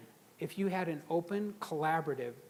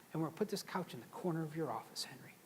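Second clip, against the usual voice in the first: whisper; 7 dB quieter, faster than usual. Retold or imitated. Conspiratorial aside to the boss.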